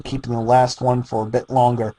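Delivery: plain reading voice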